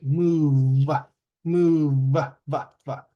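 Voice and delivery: "move" stretched, its V broken off as "va"; last-sound demo. Shown as a demonstration. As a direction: sound out "move" and repeat the voiced "va". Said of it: "the last sound is V".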